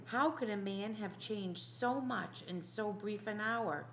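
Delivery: plain reading voice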